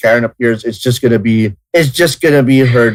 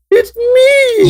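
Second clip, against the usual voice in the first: very high, hammed-up falsetto matron; Missus Doubtfire. Host trying funny voices for friends, high falsetto old-lady impression. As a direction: squeal it in a silly falsetto. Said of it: "Now you sound like Missus Doubtfire".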